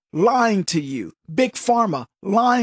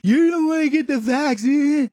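higher, mocking scold; mock critic; 6 semitones higher than usual. Host mimicking critics warning him, in a mock scolding voice. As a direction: parrot the naysayers in a put-on voice.

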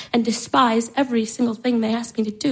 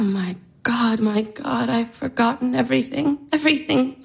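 ordinary talk

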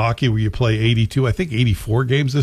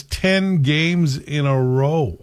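exasperated; 4 semitones higher, much slower than usual. Real, not acted. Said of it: "that's my exasperated voice".

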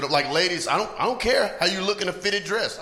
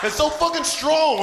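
usual speaking voice

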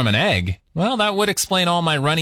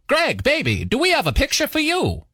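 put-on old Hollywood producer voice, a little higher; 1940s film studio voice; 9 semitones higher than usual. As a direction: play a slick 1940s studio mogul. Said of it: "are you calling from a nineteen forties film studio".

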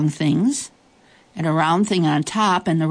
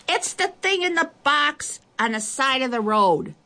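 put-on high child's voice calling far; little girl shouting; 8 semitones higher than usual. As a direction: call out directions from afar. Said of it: "the little girl shouted".

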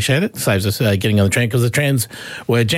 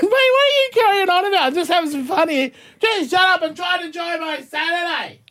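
high, raised mock scold; mock telling-off. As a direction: tell him off half-joking. Retold telling off stranger.

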